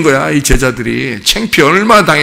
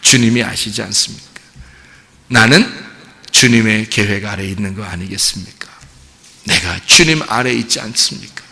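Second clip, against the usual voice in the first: low, submissive confession; humbly yielding to his Lord; humble Peter; 5 semitones lower, 4 dB quieter than usual. Retold or imitated. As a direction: confess meekly, voice lowered. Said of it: "자기가 사랑한다고 막 이렇게 나대지 않아요".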